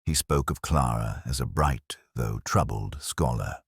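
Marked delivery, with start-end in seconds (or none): none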